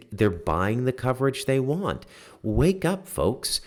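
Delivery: ordinary talk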